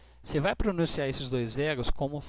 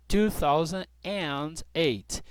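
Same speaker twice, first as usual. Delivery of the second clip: slow, careful "two thousand and eight"; slow model; much slower than usual. Shown as a demonstration. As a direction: read the year out slowly. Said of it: "Ou eu posso falar dois mil e oito".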